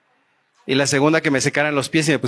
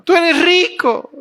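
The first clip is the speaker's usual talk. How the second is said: much higher, louder, slow lament; envious old aunt; 13 semitones higher, 4 dB louder, slower than usual. Retold or imitated. Aunt telling her brother he is rich, slow high lament. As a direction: drag out her envy of her brother.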